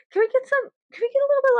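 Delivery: high pleading little voice; mock-sweet high plea; begging. Joking begging with friend.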